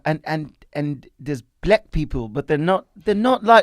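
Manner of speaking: put-on halting South African accent; Trevor Noah. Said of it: "that's how he talks".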